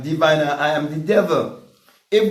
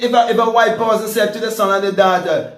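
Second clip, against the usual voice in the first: usual speaking voice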